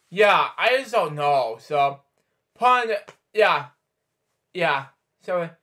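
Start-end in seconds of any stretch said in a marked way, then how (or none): none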